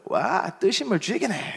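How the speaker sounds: slow, drawn-out Gyeongsang dialect; an old hand sinking into hot water, Gyeongsang style; Gyeongsang dialect senior. Retold or imitated. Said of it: "선임이 딱 들어오면서 그러는 거예요".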